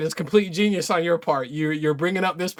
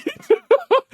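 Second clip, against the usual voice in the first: high, tearful-sounding laugh; exasperated high-pitched cackle; helpless laugh; 4 dB louder than usual. Real, not acted. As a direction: break into a high, wailing laugh. Breaks down laughing at the commenter.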